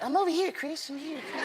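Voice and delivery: whisper-like high Michael; Michael hiding in disguise. Retold or imitated.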